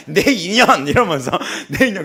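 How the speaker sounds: loud laughing outburst; laughing mock cry. Pastor joking about grabbing her hair, loud laughing shout. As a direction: yell it through laughter.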